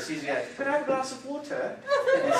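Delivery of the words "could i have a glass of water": high-pitched mock English accent; imitated English accent. Husband imitating his wife asking for water, English accent. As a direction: put on an English wife's voice, lightly. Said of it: "You should have heard her".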